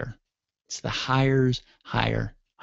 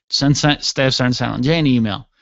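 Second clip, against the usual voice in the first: normal talking voice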